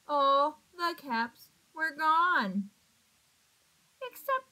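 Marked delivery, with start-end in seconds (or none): none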